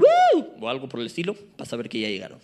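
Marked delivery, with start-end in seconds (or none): 0.0-0.6 high whooping woo; loud, high woo; cheer. Pretend. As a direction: whoop like a happy crowd. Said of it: "échenme un".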